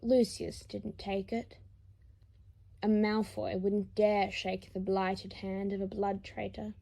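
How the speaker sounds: normal talking voice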